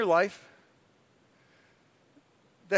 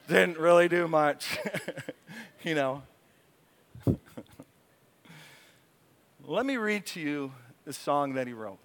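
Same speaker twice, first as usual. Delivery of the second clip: ordinary talk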